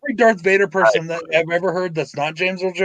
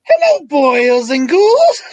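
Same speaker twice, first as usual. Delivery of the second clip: higher, louder, slow Cryptkeeper cackle voice; 4 dB louder, much slower than usual. Acted. Cryptkeeper impression.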